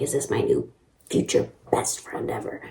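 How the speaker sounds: mock-secret whisper; whispered thought. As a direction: whisper it like a private hope. Voicing a secret hopeful thought.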